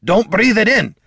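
high, loud urgent shout; roared warning. A narrator's reading. Dwarf warning bard about billowing gas, roared. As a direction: roar the warning. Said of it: "Gorm roared".

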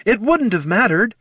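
high, distressed protest; upset outburst; heated protest. Acted. Chasing wizard, quarrel.

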